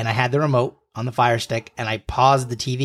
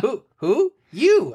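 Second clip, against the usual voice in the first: playful high squeak; 10 semitones higher, slower than usual. Retold or imitated. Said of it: "Who's on First bit".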